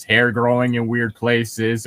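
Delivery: normal talking voice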